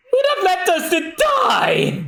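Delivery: high, slow outraged yell; high, drawn-out mock outrage; indignant shout. Survivors indignant.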